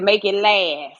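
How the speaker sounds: slow, loud put-on dog voice; mock dog voice; voicing the dog. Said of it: "He said".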